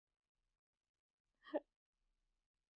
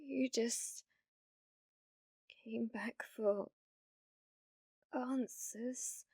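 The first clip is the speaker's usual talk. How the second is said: much softer, sinking to a whisper; wounded whisper, slow on the middle; hurt hushed whisper; 8 dB quieter, much slower than usual. Acted. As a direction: let the hurt drain the voice. Hurt that viewer wants answers.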